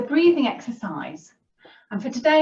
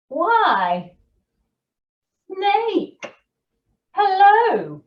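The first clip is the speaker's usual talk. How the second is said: high, lilting mock greeting; a touch of a cheery little mouse hailing someone; lightly voiced mouse; 10 semitones higher, 6 dB louder, much slower than usual. A narrator's reading. Mouse greets Snake in the woods, lightly acted high hello.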